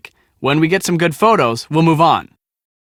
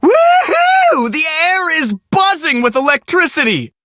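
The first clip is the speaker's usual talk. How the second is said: excited whoop; 9 semitones higher than usual. Acted. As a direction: let out a thrilled whoop. Cheering in the storm.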